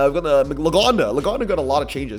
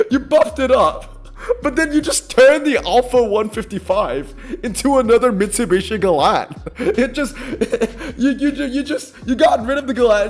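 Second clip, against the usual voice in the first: high, loud laughing through the words; real disbelieving laughter; exasperated laughter; 7 semitones higher, 6 dB louder than usual. Real, not acted. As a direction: laugh at how absurd it is. Laughing in disbelief at balance changes.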